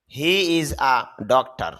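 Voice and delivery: drawn out, word by word English example; very slow model phrase. Shown as a demonstration. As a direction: stretch it out for the learner. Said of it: "teacher models sentence". (খুব ধীরে টেনে টেনে উদাহরণ)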